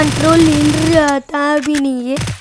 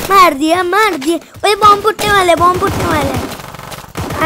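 ordinary talk

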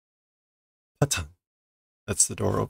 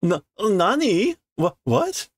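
frightened what; 11 semitones higher, slower than usual. Acted. Frightened girl asks what.

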